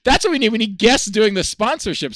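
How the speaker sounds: high, loud and thrilled; excited. Real, not acted. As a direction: burst out with delight. Host thrilled by guest's ad read.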